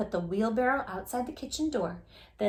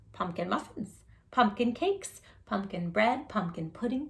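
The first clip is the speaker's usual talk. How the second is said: usual speaking voice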